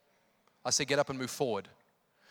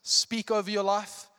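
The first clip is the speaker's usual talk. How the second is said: loud, high, drawn-out preaching; full-force preaching; 8 semitones higher, 9 dB louder than usual. Real, not acted. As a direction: preach it with urgent conviction.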